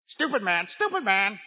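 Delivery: high squawky parrot voice; squawking parrot. Acted. Parrot insulting its owner from the freezer, squawking. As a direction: squawk it like an insulting pet bird. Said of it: "the parrot squawking".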